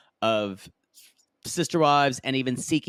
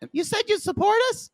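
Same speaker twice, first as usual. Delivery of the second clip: much higher, sugary pleading tone; Jenny's pleading voice; faster than usual. Retold or imitated. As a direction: plead sweetly in a high voice. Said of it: "Jenny just goes".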